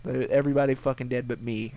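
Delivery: ordinary talk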